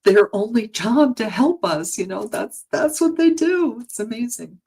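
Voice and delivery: overcome, pushing through a lump in the throat; real emotion welling up, pitch climbing; getting emotional. Real, not acted. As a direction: voice rising high with emotion, nearly breaking. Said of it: "I get a little emotional".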